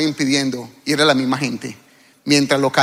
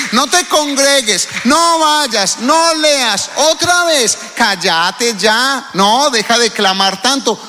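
voz burlona disuadiendo; 5 semitones higher, slower than usual. Acted. Los que quieren callar al creyente.

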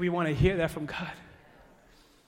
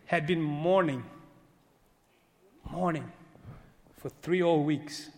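solemn reading; 4 semitones lower than usual. Acted. Reading Daniel's lament.

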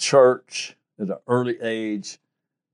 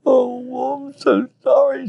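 high, mock tearful apology; an elderly embezzler's mock sobbing confession; imitating her crying; 3 dB louder, slower than usual. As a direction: play the caught grandmother breaking down. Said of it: "She started crying".

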